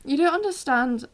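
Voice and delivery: choked up with fan emotion; teary and giggly; tearful laughing. Real, not acted. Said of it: "She actually got tears in her eyes".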